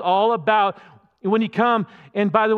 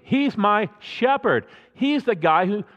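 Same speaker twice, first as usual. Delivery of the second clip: ordinary delivery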